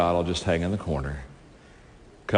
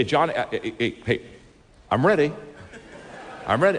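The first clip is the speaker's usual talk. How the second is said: lightly voiced eager boss; eager CEO; 5 semitones higher than usual. CEO on the golf course insisting he is ready, lightly eager. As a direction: nudge him, keen and a bit laughing.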